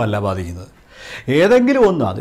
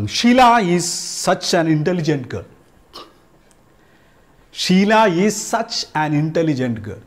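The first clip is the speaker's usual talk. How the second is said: slow example sentence; much slower than usual. Shown as a demonstration. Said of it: "അത്തരം".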